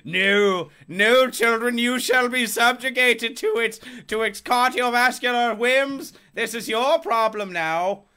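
loud, high, slow theatrical proclamation; mock tyrannical father. Father refusing his children masks, mock-tyrannical proclamation. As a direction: declaim it like a pompous patriarch, stretching words.

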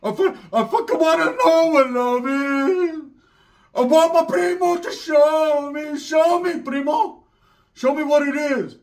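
hammed-up homie pleading.

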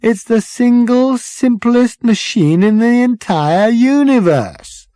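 high, slow, drawn-out exasperation; exasperated exclamation. A narrator's reading. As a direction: spell it out incredulously. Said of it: "exclaimed Ford".

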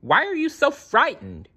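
high-pitched urgent question in a storybook voice; high, worried yell. A narrator's reading. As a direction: call it out, pitched right up. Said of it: "he yelled".

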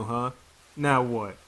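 ordinary delivery

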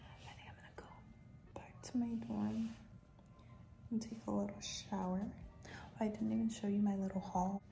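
soft whisper in a quiet room; library whisper. Real, not acted. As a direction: whisper so nobody nearby hears. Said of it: "Whispering 'cause I know people can hear me".

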